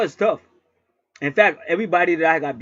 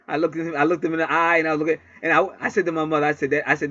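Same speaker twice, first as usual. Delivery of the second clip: ordinary delivery, nothing marked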